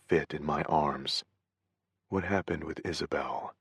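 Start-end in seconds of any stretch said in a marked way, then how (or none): 2.1-3.6 soft, hushed ask; quiet question. A narrator's reading. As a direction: ask gently close to her ear. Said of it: "I asked quietly".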